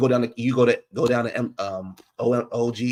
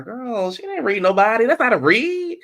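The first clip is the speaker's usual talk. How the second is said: exasperated disbelief; 11 semitones higher, 4 dB louder than usual. Real, not acted. Dismissing Twitter's reaction.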